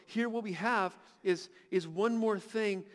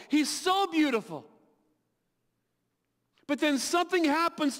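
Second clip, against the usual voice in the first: raised, driving sermon voice; preaching at full force; 6 semitones higher, 5 dB louder than usual. Real, not acted. Preacher urging the congregation toward grace, fervently. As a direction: build the fervour, hammer each repeat.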